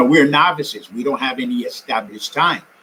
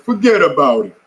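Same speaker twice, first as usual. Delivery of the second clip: a quick imitation of a Brooklyn accent, a bit louder; 2 semitones higher, 5 dB louder than usual. Brooklyn spectators cheering the marathon runners, in a mock Brooklyn accent. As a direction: toss off "Forget about it" like a Brooklynite cheering from the curb. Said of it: "in that Brooklyn accent".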